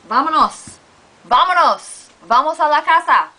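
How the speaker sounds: calling out. Shown as a demonstration. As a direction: call the class to leave. Teacher calls out let's go.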